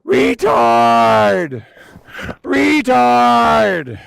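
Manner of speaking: loud high full-throated scream; screaming at top of lungs. Retold or imitated. Nurse screams the nickname in the night ward, loud high scream. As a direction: bellow it across the ward. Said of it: "she screamed at the top of her lungs".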